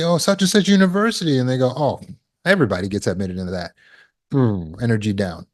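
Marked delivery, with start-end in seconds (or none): 0.0-1.4 high, quick proud reply; lightly voiced proud student; proud answer. You name school proudly. 1.8-3.7 lower, fast, offhand brush-off; a touch of a friend shooting the news down; dismissive putdown. Retold or imitated.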